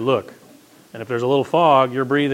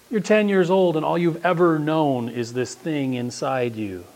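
normal talking voice

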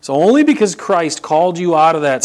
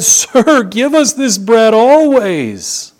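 higher, louder, eager begging; excited plea; 10 semitones higher, 6 dB louder, much slower than usual. Acted. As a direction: plead for the bread like a hungry crowd, slowly. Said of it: "They're getting a little excited here".

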